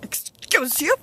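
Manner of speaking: offended retort. Retold or imitated. To the classmate mocking her pilot, indignant and high. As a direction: sass him back, offended.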